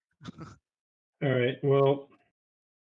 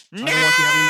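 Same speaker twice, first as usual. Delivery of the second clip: high, loud outburst; excited shout; 9 dB louder than usual. Acted. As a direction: shout it gleefully. Stealing opponent's island, excited.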